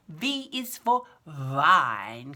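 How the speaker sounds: V is for vine said very slowly, V buzzed against the lip; slow clear V. Shown as a demonstration. Teacher modelling V is for vine. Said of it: "put your teeth over your lip".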